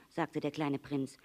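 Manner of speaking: ordinary delivery, nothing marked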